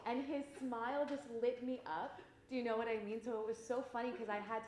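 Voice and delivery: excited. Real, not acted. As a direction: lit up with joy as she tells it. Excited retelling of joyful moment.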